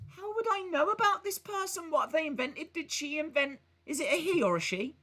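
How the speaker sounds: high, squeaky questions; mock bafflement. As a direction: play the clueless host. Mock baffled about Tesla.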